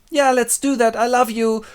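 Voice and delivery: high, keen sweetheart voice; eager lover. Acted. Lover agreeing to run away, eager. Lover answers elopement plan.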